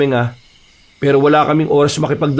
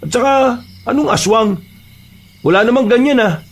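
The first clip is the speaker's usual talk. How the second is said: higher-pitched incredulous dismissal; exasperated, pitched-up skepticism; scoffing disbelief; 7 semitones higher than usual. Acted. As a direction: dismiss it as an old folks' tale. Dismissing the aswang story.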